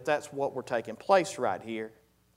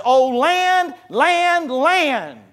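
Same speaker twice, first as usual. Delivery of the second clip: high, loud prophetic cry; drawn-out, ringing call on every word; most emphatic cry; 12 semitones higher, 11 dB louder, much slower than usual. Acted. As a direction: cry out each "land" to a whole nation. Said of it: "wanted to put the most emphasis on it".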